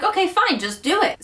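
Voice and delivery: high, resigned "fine"; exasperated giving in. Retold or imitated. Wife reluctantly letting husband paint another wall, exasperated. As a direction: give in with a sigh of impatience. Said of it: "So I was like".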